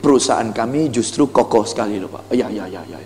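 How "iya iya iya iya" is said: low, soft, mumbled yes-yes of a customer; passive customer agreeing. Acted. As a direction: nod along with a low, quiet drone. Customer nodding along.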